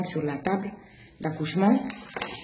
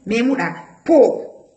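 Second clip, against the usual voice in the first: slow, louder local-language reply; Yaya answering; 5 semitones higher, 5 dB louder, slower than usual. Retold or imitated. Yaya telling the nurse to pull whatever she grabs, slow and louder. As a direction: tell her to pull anyway, slowly. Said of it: "Yaya lui dit".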